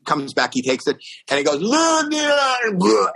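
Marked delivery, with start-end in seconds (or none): 1.6-3.1 put-on drawn-out high drunken holler; drunk fan. Drunk fan spots Lou round the corner, long holler. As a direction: stretch the name out like a wasted superfan. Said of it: "The guy's drunk".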